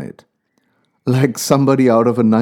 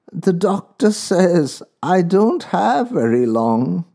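put-on old woman voice; 6 semitones higher, slower than usual. A narrator's reading. Old woman continues softly.

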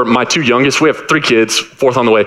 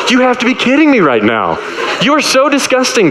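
much higher, incredulous groan; mock-disgusted dad, you can't believe it; exasperated disbelief; 9 semitones higher than usual. Dad finding son with urinal cake.

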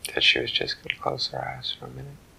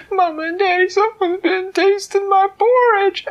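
a tiny cub tattling to his parents; squeaky high little bear wail; baby bear voice; 8 dB louder than usual. Acted. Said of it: "Baby Bear said".

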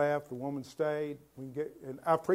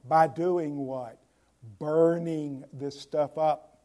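emphatic preaching; 7 dB louder, slower than usual. Acted. Emphatic preaching question and answer.

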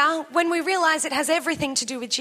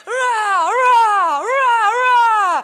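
loud, higher hammed-up war cries; hammed-up prayer battle shouting; mock battle yell; 6 semitones higher, 8 dB louder, much slower than usual. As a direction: yell "rah" like fighting off demons. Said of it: "full-on prayer battle".

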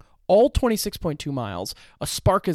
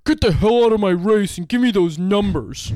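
furious, loud yelling race official; Jock voice; 5 semitones higher, 9 dB louder than usual. Retold or imitated. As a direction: bellow at her to get off the course. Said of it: "that's a good Jock voice".

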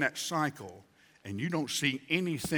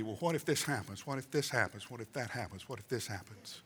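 fading worried muttering; 4 semitones lower, 8 dB quieter, faster than usual. Acted. Acted anxious repeated what-ifs, fading.

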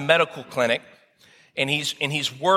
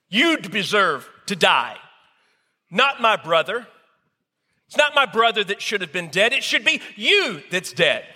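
higher and rising, bitter accusing cry; grieving fury; 6 semitones higher than usual. Acted. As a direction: hurl the blame at him, voice climbing. Grieving brother accuses the man.

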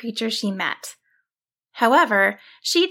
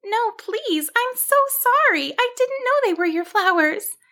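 high-pitched pleading sheep voice; choked with fear; 12 semitones higher than usual. A narrator's reading. Sheep pleading with the wizard not to curse her, fearful. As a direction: beg for mercy in a high, frightened squeak. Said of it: "choke out around her fear".

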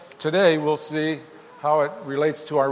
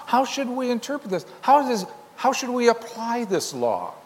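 much higher, puzzled questioning; questioning teachers; 8 semitones higher than usual. Retold or imitated. Teachers of the law puzzling over the law, higher questioning voice. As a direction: ask it like anxious scholars.

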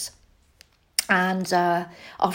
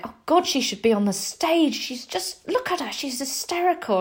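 higher, lightly voiced adult friends; a hint of gushing grown-ups; imitating parents' friends; 7 semitones higher than usual. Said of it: "my parents' friends were always like".

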